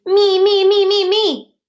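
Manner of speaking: high, loud eager shout. Acted. Froggy shouting to go first at circle time. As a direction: beg to be picked first. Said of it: "Froggy shouted".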